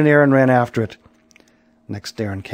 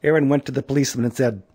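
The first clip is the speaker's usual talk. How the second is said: plain reading voice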